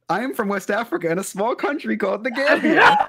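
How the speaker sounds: playing a West African villager, laughing through it; mock Gambian man. To the scammer, posing as a villager: laughing put-on voice.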